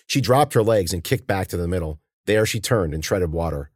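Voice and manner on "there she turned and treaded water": ordinary delivery, nothing marked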